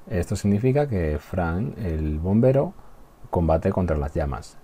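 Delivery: ordinary talk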